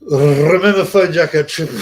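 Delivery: huge loud trill opening "Remember"; hammed-up rolled R. Mimics prank-call show with rolled R.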